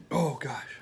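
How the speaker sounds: whispered gasp. Real, not acted. Coughing from chili heat.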